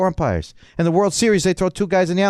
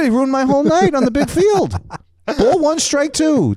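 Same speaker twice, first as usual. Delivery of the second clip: comic outrage; 12 semitones higher, 3 dB louder than usual. Retold or imitated. Comic relives the umpire ruining his night, high outraged rant. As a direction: relive the tantrum at full pitch.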